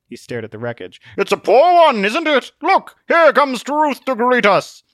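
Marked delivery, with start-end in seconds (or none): 1.2-4.8 high, loud theatrical voice; blustering Zealot, breezy. Acted. Zealot spots Truth coming to greet them, breezy bluster. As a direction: announce it grandly, pitched way up.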